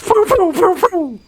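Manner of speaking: very high mock gunshot noises; loud, high-pitched "poo" noises as a cartoon monkey; American monkey noises. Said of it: "what an American monkey would sound like".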